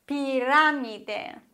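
Pirámide, slowly, stress on -rá-; Pirámide spoken slowly for the learner; slow Spanish example. Shown as a demonstration. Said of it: "Ich habe drei Beispiele".

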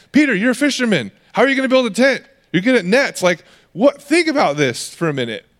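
high, incredulous rebuke of the disciples; a teacher who can't believe his students; exasperated Jesus. Retold or imitated.

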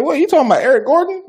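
high, loud, quick burst of disbelief; mock incredulous reaction. Sister reacting to a minister's vision of her husband, incredulous.

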